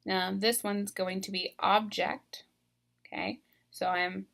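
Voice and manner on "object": OBject, first syllable stressed; "object" said OB-ject as a noun. Shown as a demonstration. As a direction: lean on the start of "object". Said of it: "I'm stressing this first syllable".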